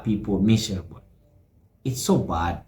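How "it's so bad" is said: ordinary talk, nothing marked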